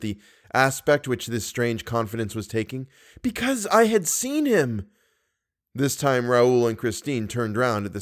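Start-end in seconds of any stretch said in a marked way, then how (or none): 3.2-4.9 much higher startled answer; a haunted girl remembering; frightened. A narrator's reading. To Raoul, a frightened higher-pitched answer. Christine explains her fright.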